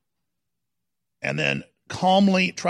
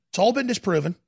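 usual speaking voice

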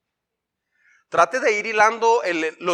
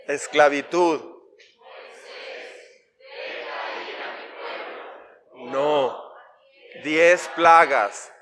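slow, drawn-out list recited together; slow group recitation; much slower than usual. Shown as a demonstration. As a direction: lead the class through the list slowly. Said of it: "vamos desde Mar de Galilea hasta monte Sinaí".

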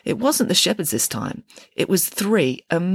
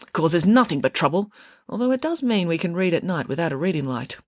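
ordinary delivery

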